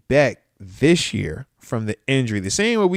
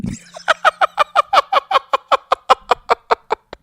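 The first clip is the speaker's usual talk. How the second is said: laugh; 5 dB louder than usual. Real, not acted. Laughing at own petty joke.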